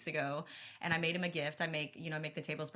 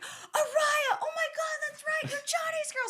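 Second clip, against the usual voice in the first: much higher and louder, thrilled; excited; 7 dB louder, slower than usual. Retold or imitated. Imitating Jerry realizing she is Johnny's girl, thrilled. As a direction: burst out in happy recognition.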